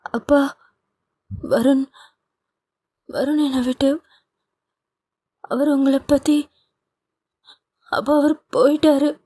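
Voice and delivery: high, broken sobbing voice, gasping out each fragment; a daughter sobbing to her father, barely getting words out. A narrator's reading. Daughter sobs to her father after her lover leaves, high and broken. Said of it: "Nithya விம்மியபடி".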